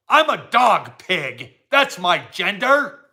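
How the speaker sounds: usual speaking voice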